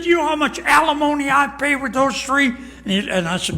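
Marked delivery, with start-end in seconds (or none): 0.0-2.5 high, louder indignant complaint; exasperated executive. Retold or imitated. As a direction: whine about the alimony. Executive complaining about alimony.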